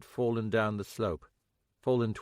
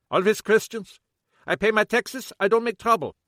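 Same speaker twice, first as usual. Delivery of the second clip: put-on higher, louder accented protest; indignant German shopkeeper; 11 semitones higher, 8 dB louder than usual. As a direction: insist you're blameless, aggrieved. Kappelhoff protests.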